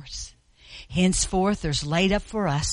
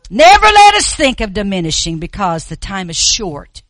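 preaching; 11 dB louder than usual. Real, not acted. Preaching at full force.

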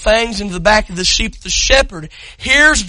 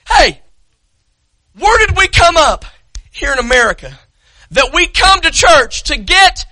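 full-force preaching; 8 semitones higher than usual. Acted. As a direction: rail against the congregation from the pulpit.

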